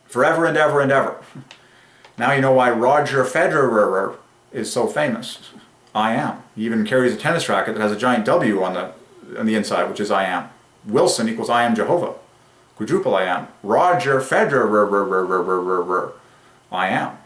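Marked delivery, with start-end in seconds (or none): 0.1-1.2 rapid looping. Real, not acted. Playful endless repetition of phrase. 13.6-16.1 Federer stretched into a long run of repeated er sounds; drawn-out name. Real, not acted. Playfully stretching the name's repeating syllables.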